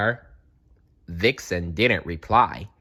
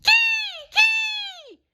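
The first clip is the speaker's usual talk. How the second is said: piercing, very high bird screech; very high hawk call; kestrel cry; 4 dB louder than usual. A narrator's reading.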